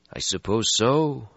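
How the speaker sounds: put-on old European, slow and melancholy; weary old vampire. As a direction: sigh out an ancient regret. Stretsky voiced in story.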